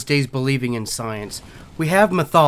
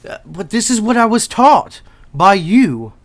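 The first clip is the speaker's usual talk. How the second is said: raised, higher-pitched indignant voice; heated protest; 12 semitones higher, 22 dB louder, slower than usual. Acted. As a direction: stammer in, then push back loudly. Castor protests to his teacher Columbus.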